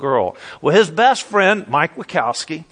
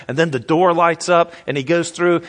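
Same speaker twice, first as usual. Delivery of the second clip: usual speaking voice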